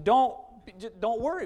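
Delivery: "Don't" hit hard and loud; stress on don't. Shown as a demonstration. Pastor stressing "Don't" as a command. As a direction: punch "Don't" as an order. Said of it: "It's a command".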